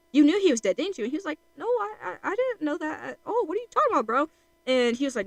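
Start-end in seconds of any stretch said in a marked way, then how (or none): 0.1-1.0 mock accusation, higher and louder; accusing. Grieving brother confronting the one who gave false hope, accusing. Acted accusation in retold argument. 1.6-4.3 high-pitched, stammering mock innocence; flustered denial. Accused character denying he knew about the brother, flustered.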